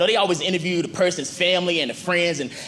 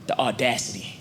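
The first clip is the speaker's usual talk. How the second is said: quiet scoff; 9 semitones lower, 5 dB quieter than usual. Acted. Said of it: "And I just be like".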